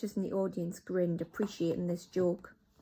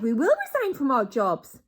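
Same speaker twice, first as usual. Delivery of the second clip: higher, loud eager promise; eager witches; 6 semitones higher, 8 dB louder than usual. Acted. Witches promise their leader, loud eager. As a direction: agree at once.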